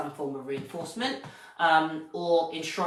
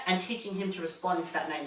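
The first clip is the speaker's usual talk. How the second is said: ordinary talk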